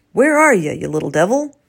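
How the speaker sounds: loud, searching call to the squirrel. Acted. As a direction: Bertrand hunting his nemesis from the window, calling out. Bertrand calls out for squirrel.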